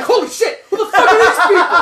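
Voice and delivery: very high-pitched comic panic; mock terrified. Explorer suddenly facing armed natives, mock terrified. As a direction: squeal it out, laughing through the fear. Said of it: "you're just fucking scared out of your fucking mind".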